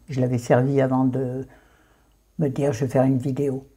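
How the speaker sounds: ordinary talk, nothing marked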